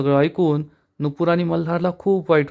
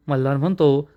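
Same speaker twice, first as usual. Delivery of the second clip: usual speaking voice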